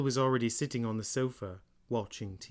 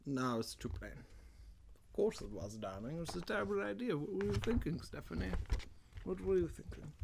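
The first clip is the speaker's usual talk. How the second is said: put-on drunk British aside; 7 semitones higher, 9 dB quieter than usual. Mocking the heroine as a drunk British guy. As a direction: mutter it like a tipsy British bloke. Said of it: "my drunk kind of British guy".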